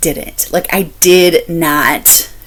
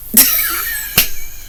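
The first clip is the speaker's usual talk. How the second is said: high embarrassed shriek of laughter; high guilty laugh; 8 dB quieter than usual. Real, not acted. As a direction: burst into a squealing laugh. Embarrassed at own dislike.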